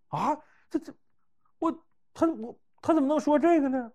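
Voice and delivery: stammering high shocked voice; flustered shock. Retold or imitated. Xiaoying learns her secret leaked, stammering in high shock. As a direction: sputter in disbelief, high and halting.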